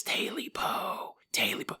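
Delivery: soft, stretched creature whisper; eerie whisper. Acted. Taily Po shows back up at night, eerie whisper. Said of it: "repeats his phrase".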